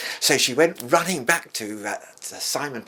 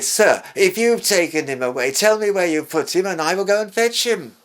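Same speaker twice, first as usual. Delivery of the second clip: higher and louder, rising plea; pleading Mary; 8 semitones higher, 6 dB louder than usual. Retold or imitated. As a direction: beg the gardener for the body.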